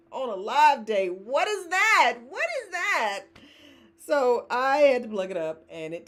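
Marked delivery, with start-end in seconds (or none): 1.1-2.2 high, drawn-out what is that; slow exasperated question; mock outrage. Real, not acted. Mock outrage at dead camera. 2.3-3.2 even higher, squeezed disbelief; high incredulous squeak; exasperated repeat. Real, not acted.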